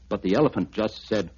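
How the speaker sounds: plain reading voice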